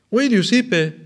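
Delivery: lifts the voice high and loud to announce. Real, not acted. Preacher to the faithful, raising the voice high and loud. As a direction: ring it out over the congregation. Preacher proclaims John's words.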